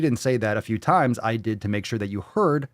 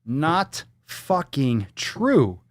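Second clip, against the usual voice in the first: slow, flat-out denial; slow disgusted rebuttal; much slower than usual. Real, not acted. Vlogger rejecting the fact, slow and disgusted. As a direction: drag out each word in disgust.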